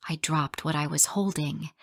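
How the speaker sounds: plain reading voice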